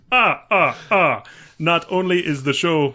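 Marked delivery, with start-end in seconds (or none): 0.1-1.2 attempted Transylvanian vampire accent; staccato laugh, then a botched Transylvanian accent; the Count. Resuming the listener review as the Count, shaky grasp of Transylvanian accent. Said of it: "it reminds you that it's Transylvania".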